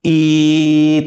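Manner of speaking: ई held long and steady, unchanged; drawn-out vowel. Shown as a demonstration. Teacher showing the sound remains the same when stretched, drawn-out ई. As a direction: stretch ई out on one level note. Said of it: "कितना भी लंबा खींचे".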